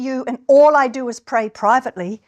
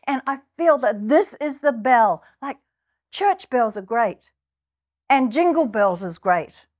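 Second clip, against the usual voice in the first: normal talking voice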